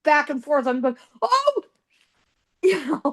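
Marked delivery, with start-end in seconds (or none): 1.2-1.6 short high mock cry of alarm; startled yelp. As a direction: yelp as if shots rang out behind you. Retold fright at gunfire.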